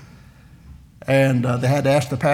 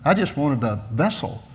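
usual speaking voice

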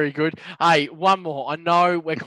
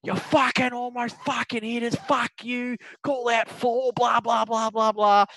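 retold ranting; 7 semitones higher, slower than usual. Golfer yelling at the group behind him, retold ranting. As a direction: rattle off a mock angry rant. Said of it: "then he starts going again".

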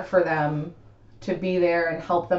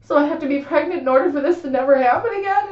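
whiny disbelief; 7 semitones higher, 4 dB louder, faster than usual. Retold or imitated. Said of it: "And I was like".

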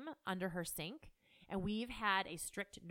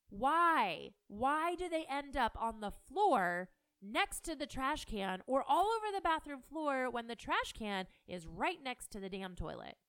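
louder and higher, fed-up why; a real exasperated parent's rant; 5 semitones higher, 6 dB louder than usual. Real, not acted. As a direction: vent like you've said it a hundred times. Rant about toilet paper rolls.

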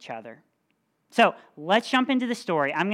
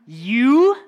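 loud, high incredulous you; hammed-up giant scoff; scoffing disbelief; 6 semitones higher, 11 dB louder than usual. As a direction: sneer down at the small boy.

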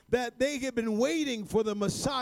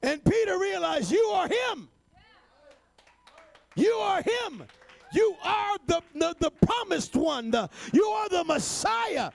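higher, slow-building shouted proclamation; preacher shouting Peter's confession to the congregation; preaching at full force; 7 semitones higher, slower than usual. Acted. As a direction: cry it out like Peter's revelation.

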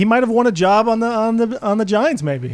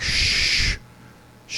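a whispered librarian shush; mock shush at a noisy caller; 8 dB quieter than usual. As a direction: hush someone like a stern librarian. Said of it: "shushing you".

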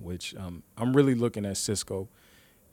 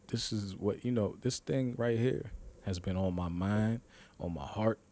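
ordinary talk, nothing marked

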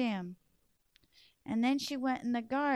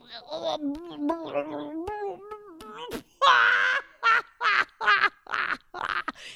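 high, loud wild cackle; the man laughing as the stings set in; big cackling laugh; 7 semitones higher, 4 dB louder than usual. Acted. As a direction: throw back your head and cackle. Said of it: "He started laughing".

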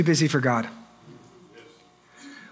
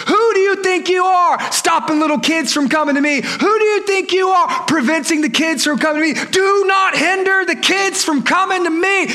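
high-pitched indignant yell; angry yelling; 7 semitones higher than usual. Acted. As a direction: lose your cool and yell at them. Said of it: "He just starts yelling at the disciples".

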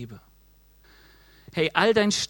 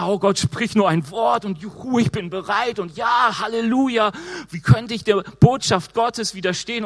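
loud hammed-up cheering, halleluja; eager mock shout; 5 semitones higher, 5 dB louder than usual. Preacher mimics a believer eagerly shouting his readiness. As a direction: play the overeager believer crying out his readiness.

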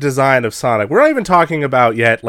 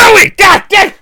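exasperated shout; 8 dB louder than usual. Real, not acted. Cohost protests quote.

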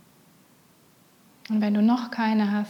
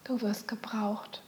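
whispering; 7 dB quieter than usual. Shown as a demonstration. Meditation, whispered repeat.

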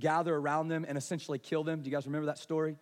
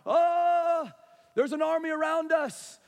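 loud, high panicked cry; hammed-up alarm, long yelled "oh" first; freaking out; 9 semitones higher, 7 dB louder than usual. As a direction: play a panicking servant boy. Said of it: "The servant boy's freaking out".

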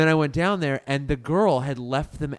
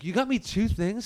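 mock surprise; 7 semitones higher than usual. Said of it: "he's like".

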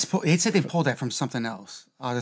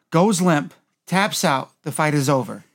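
ordinary talk

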